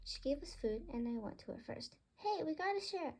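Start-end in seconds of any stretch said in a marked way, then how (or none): 2.2-3.1 higher, bossy call; telling a greedy bird off; scolding bird voice. Acted. Birds bicker over food.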